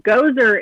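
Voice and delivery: Gozer, like the Ghostbusters character; pronounced like Gozer. Shown as a demonstration. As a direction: say Gozer as GO-zer, with a z sound. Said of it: "how I've been told his name is pronounced".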